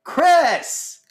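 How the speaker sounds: sudden loud yell of the name; a prank shout to ruin the take. Real, not acted. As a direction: blurt the name out high and loud. Interrupting the intro yell.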